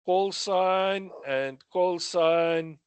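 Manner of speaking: slow, spelled out. Shown as a demonstration. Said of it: "And then the".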